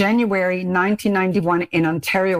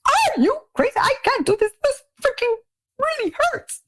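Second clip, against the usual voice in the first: pained cursing. Retold or imitated. Waxed thigh, shrieking at the beautician in pain. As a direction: yelp it as the wax rips off. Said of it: "And I cursed".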